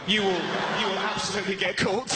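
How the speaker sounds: normal talking voice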